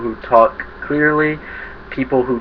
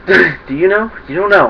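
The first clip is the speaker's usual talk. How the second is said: playful laughing tease; 8 semitones higher, 6 dB louder than usual. Real, not acted. Teasing the viewer about "you know.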